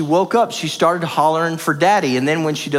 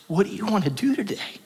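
imitated child whisper; 5 semitones higher, 6 dB quieter than usual. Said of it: "She goes".

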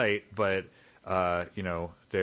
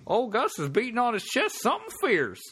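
high hammed-up old-timey prospector; squeaky old miner shouting to the boys; 12 semitones higher than usual. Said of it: "old-timey prospectors".

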